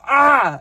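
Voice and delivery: loud, high-pitched cry of pain; pained yell. Retold or imitated. Friend stepping on something sharp in a basement, startled pained yell. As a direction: let out a sudden high yelp. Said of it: "you just hear him go".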